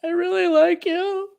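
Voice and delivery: sing-song imitation of a girl letting him down; mocking a girl. Mock girl voice, rejection.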